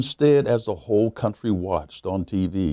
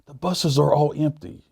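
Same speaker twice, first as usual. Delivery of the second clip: wife's voice; 9 semitones higher than usual. A narrator's reading. Wife voiced in retold story.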